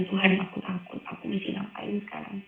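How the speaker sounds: almost under her breath, afraid; frightened prayer. Retold or imitated.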